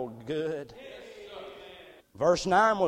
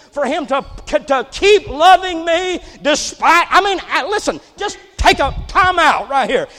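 loud and higher, building to a shout; full-force preaching; 11 semitones higher, 15 dB louder than usual. Acted. Preacher lifting the church, loud fervent preaching. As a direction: preach it out to the congregation.

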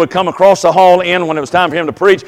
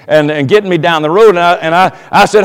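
normal talking voice

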